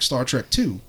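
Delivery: ordinary talk, nothing marked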